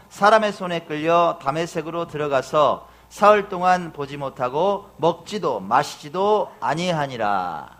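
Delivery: loud, declaimed Bible reading; scripture reading. A narrator's reading. Preacher reads Saul's conversion to the congregation, solemn declamation. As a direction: proclaim the passage with full voice.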